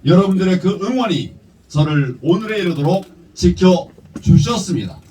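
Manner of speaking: rousing political speech; formal speech. Real, not acted. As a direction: deliver it like a campaign address. Prepared speech read at rally.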